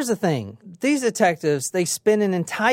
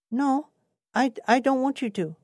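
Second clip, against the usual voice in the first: put-on higher, softer Vietnamese-accented voice; imitated Vietnamese accent; 7 semitones higher, 3 dB quieter than usual. Suspect refusing detectives a garage search, imitated Vietnamese accent. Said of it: "That's how he sounds".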